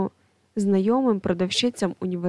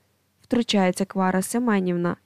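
ordinary delivery, nothing marked

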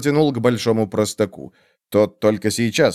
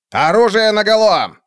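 high loud bark of command; barked order; 11 semitones higher, 5 dB louder than usual. A narrator's reading. The preda orders his guards to draw, loud barked command. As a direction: bark it at your men. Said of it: "рявкнул он".

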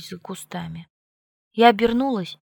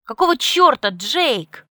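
angry growl, louder and higher; 6 semitones higher, 7 dB louder, slower than usual. A narrator's reading. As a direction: snarl it out furiously. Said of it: "прорычала я".

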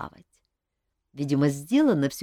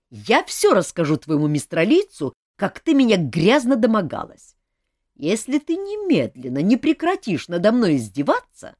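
mock indignation; 4 semitones higher, 7 dB louder than usual. King scolds Olga mock-angrily.

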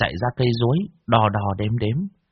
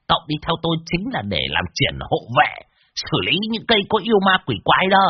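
high, hammed-up mocking voice with chuckles; grandly mock-introduce him, laughing; mocking introduction; 6 semitones higher than usual. Old Đồng mockingly introducing Tẩy to the widow, high and laughing. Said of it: "Lão Đồng cười phe phé".

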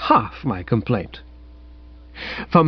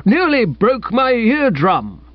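grumbling; 9 semitones higher, slower than usual. Acted. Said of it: "grumbled old Bassett".

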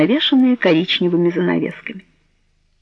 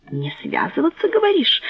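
incredulous, rising heated retort; 9 semitones higher, 5 dB quieter than usual. Acted. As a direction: throw the words back hotly. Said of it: "горячился Джованни".

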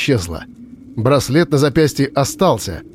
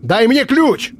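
shouting; 11 semitones higher, slower than usual. A narrator's reading. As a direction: bark the order. Demanding the key in fire.

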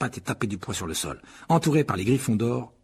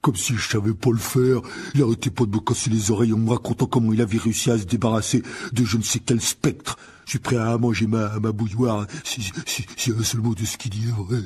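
grumbling gamekeeper; 4 dB louder than usual. A narrator's reading. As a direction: grumble heavily about a know-it-all. Voices giant gamekeeper Hagrid. (garde-chasse bourru qui ronchonne)

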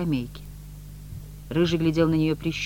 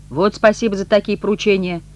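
louder, higher sarcastic thanks; offended exclamation; 5 semitones higher, 8 dB louder than usual. A narrator's reading. Rebuffed messenger grumbling about his errand, offended. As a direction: complain aloud, stung. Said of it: "обидевшись, воскликнул Рыжий".

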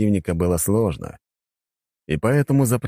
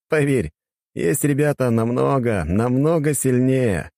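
higher, knowing, slowing at the end; knowing hero; 7 semitones higher, slower than usual. Acted. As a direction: drag out the last words knowingly.

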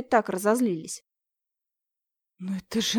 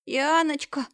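high sobbing call; half cry, half sob; 6 semitones higher, slower than usual. Acted. Neighbour calling Yana through tears, high sobbing cry. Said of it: "полувскрик, полувсхлип".

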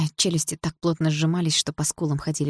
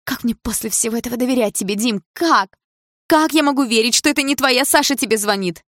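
higher and louder, pleading in anger; desperate jealous outburst; 7 semitones higher, 5 dB louder than usual. A narrator's reading. Polina demands how she can trust Dima, desperate shout. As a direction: break out, begging to be told.

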